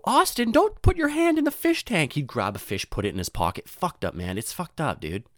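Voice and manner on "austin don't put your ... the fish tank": high, loud teacher's reprimand; scolding teacher. Retold or imitated. Teacher warning Austin off the fish tank, scolding. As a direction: imitate an alarmed teacher calling out a pupil. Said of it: "our teacher would have to go".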